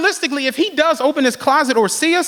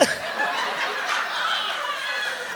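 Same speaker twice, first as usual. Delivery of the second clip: silent wheeze laugh; 14 semitones higher, 10 dB quieter than usual. Real, not acted.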